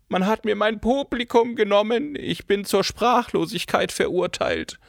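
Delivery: a diva mourning her lost stage; melodramatic actress. A narrator's reading. Cora's theatrical monologue. (theatralischer Monolog einer Diva)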